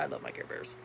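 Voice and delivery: whispered softly; whispered fondness. Real, not acted. Showing her Care Bears to viewers, soft whisper. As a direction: whisper it fondly to yourself.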